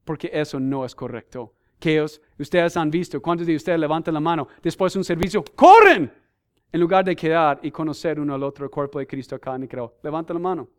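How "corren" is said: shout. Acted. Crowd runs after service.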